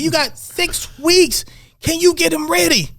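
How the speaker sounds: slow pressing challenge. Retold or imitated. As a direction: lean on him, word by word. Retold argument, Amir presses.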